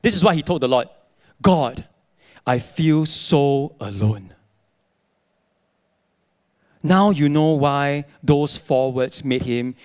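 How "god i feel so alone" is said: slow, low, lonely confession; understated, heavy-hearted prayer; acted loneliness. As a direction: confess it slowly to God, voice dropped. Retells Aaron's lonely prayer.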